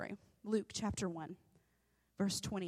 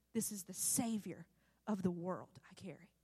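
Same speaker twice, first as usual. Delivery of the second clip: soft, low inner thought; hushed awe; 5 semitones lower, 8 dB quieter than usual. Acted. Preacher voices Mary's thought as baby moves, hushed. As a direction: drop to a near-whisper, pausing midway.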